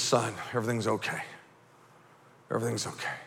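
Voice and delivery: low, hushed, soothing dad voice; calm the child softly and low; reassuring dad. Retold or imitated. Dad to his scared son in the car, reassuring.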